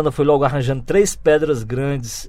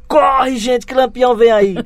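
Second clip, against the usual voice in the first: high, loud cry of alarm; retold boyish shout of warning; 10 semitones higher, 7 dB louder, slower than usual. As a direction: yell it out to scare the family. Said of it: "chegou aos gritos, aos berros e disse".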